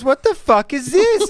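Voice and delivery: high, loud mock outrage; angry wife. Wife demanding to know what husband is watching, angry. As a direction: imitate an indignant, exasperated partner. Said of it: "Katie got angry at you as well".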